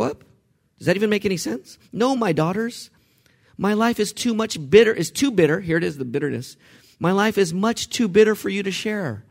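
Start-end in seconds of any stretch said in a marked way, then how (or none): 1.9-9.2 bitter lament. Acted. Naomi voiced lamenting bitterness.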